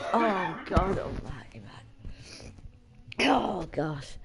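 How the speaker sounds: breathless whisper under the breath; laughing whisper. Real, not acted. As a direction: wheeze it out spent from laughing. Whispering through exhausted laughter.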